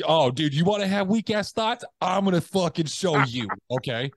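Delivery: high, hammed-up drill sergeant taunt; mock-threaten yourself like a coach gone wild; aggressive inner voice. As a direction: inner drill sergeant mocking weak thoughts, high and cocky. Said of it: "another thought that's coming, be like".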